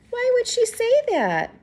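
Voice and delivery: ordinary delivery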